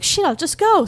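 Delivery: sudden loud frustrated curse; mock panicked exclamation; exasperated outburst. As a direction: blurt it out, annoyed. Retelling panic over missed movie.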